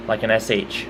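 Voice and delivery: ordinary delivery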